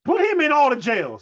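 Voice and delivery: mock shout. Real, not acted. Co-host demanding the preacher be jailed, mock shout. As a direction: yell it like passing sentence.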